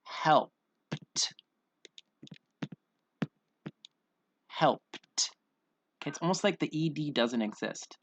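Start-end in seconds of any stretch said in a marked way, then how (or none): 0.0-4.8 "Helped" broken into help, then a separate puff of t; exaggerated "Helped" with only a whispered t for ed; vanishing ED. Shown as a demonstration. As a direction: hold back, then pop the t on "Helped". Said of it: "we're saying it like this".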